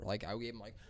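usual speaking voice

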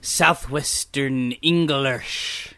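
slow, drawn-out Southwestern English burr; Southwestern English accent. Pretend. Friend naming the accent while doing it, in Southwestern English. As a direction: stretch it in a West Country English accent. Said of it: "A Southwest Western, English?".

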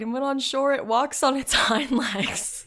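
reads on, breaking into a laugh; laughing while reading. Real, not acted. Reading the bunyip report aloud, cracking up mid-line. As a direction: giggles through the words hind legs. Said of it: "I didn't mean to start laughing".

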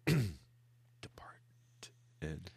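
slow breathy whisper on depart. Pretend. Aside to his co-host, a slow mock whisper. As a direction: draw depart out in a hush.

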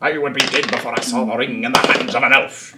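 lightly voiced stubborn dwarf; Gimli impression. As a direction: declare it like a proud, stubborn dwarf. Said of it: "It's very Gimli of me".

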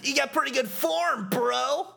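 high, chummy hype voice; high cartoon encouragement; upbeat game character. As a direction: pitch it up like an excitable bro. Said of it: "He says".

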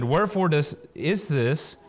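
complaining crowd. Acted. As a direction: whine it at Moses like a parched crowd. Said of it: "the people murmured against Moses and said".

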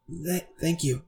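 a hint of a shy, stammering girl; hesitant girl. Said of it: "she kind of takes it and she goes".

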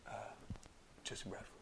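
hushed secretive whisper of his own name. Retold or imitated. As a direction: breathe the name low like a secret. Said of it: "he whispers".

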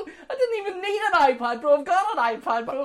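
high, loud comic whine of frustration; angry lament. Real, not acted. Thwarted buyer lamenting the iPad Pro to co-host, angry. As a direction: wail it over the other person, half laughing. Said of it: "I'm so angry".

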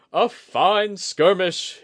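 high, slow triumphant cry; a loud, drawn-out victory cry; cried out. A narrator's reading. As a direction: call it out proudly across the yard. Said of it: "he cried".

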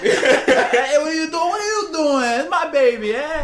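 loud, high-pitched mock Italian accent; Italian grown man. Italian men fighting over the baby, hammed-up Italian accent. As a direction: argue like a hammed-up Italian guy. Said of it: "they became Italian grown men".

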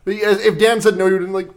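normal talking voice

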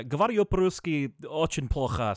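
Russian accent, lightly done. Shown as a demonstration. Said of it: "sort of have Russian accent".

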